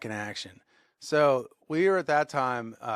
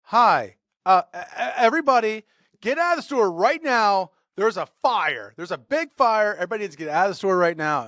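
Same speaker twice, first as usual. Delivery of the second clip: high, loud imitated alarm call; stammer, then urgently order everyone out; panicked intercom announcement; 7 semitones higher, 6 dB louder than usual. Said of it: "I hear on the, on the intercom".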